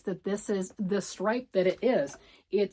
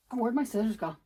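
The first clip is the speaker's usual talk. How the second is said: ordinary delivery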